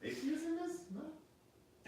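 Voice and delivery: mock squeaky surprise. Mock incredulous high voice.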